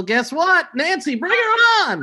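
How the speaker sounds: building to a high call-out; mock TV host introducing a surprise guest; show host announcement. Host springing the hated teacher on the guest, mock announcer.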